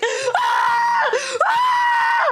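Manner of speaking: very high, shrieking scream; hammed-up high shriek; imitated panicked scream. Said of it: "screaming her head off".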